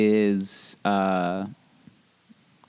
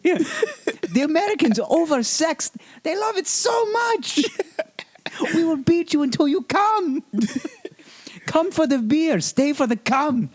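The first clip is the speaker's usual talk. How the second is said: much higher, laughing German tourism pitch; Sven's voice. Pretend. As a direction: squeak it out like a giddy German official. Continues Sven voice.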